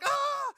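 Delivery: a sudden high, loud mock scream of terror; mock panic scream. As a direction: yelp like the plane is going down, half joking. Mock plane crash panic.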